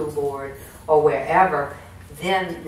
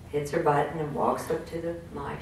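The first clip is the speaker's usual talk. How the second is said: ordinary talk, nothing marked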